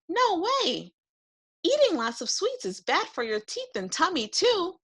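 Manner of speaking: put-on high boy voice, gently firm; a boy saying no to sweets; firm little boy. George scolds kindly.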